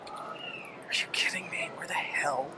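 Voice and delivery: hushed unvoiced whisper. Real, not acted. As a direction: whisper it, trying to be sneaky. Sneaking, hushed aside.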